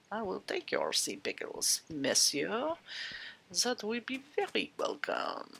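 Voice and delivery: put-on French accent with a courtly air. Gamer taking sea pickles from a villager, French accent. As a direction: play a polite Frenchman, hammed-up accent. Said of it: "French accent".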